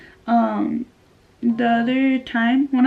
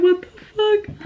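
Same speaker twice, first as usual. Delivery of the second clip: high-pitched shocked exclamation; retold disbelief; 10 semitones higher than usual. Retelling shock at reschedule.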